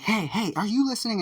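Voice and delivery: high, loud call to get his attention; yelling in his ear. Retold or imitated. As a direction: shout "hey, hey" right at his ear. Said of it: "stop yelling in my ear".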